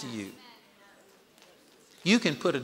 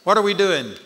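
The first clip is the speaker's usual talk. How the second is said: loud, raised-pitch shout to the room; rally a big crowd; calling out to the crowd; 6 semitones higher, 8 dB louder than usual. Real, not acted. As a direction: project it high and loud over applause. Calls out to the crowd.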